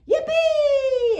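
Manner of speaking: very high, loud cheer; yelled. Acted. Kids cheering about ice skating, loud high yell. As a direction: whoop with joy. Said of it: "everybody yelled".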